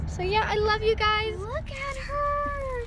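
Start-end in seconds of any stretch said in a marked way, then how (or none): 1.4-2.9 cooing. Real, not acted. Cooing over new puppy.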